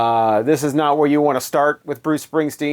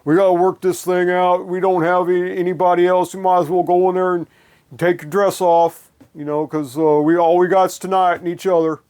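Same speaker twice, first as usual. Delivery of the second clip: mock crooning [name] persona, a bit higher; [name] love song voice; 3 semitones higher than usual. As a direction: ham up a heartland rocker sweet-talking his baby. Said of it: "it doesn't have the whole".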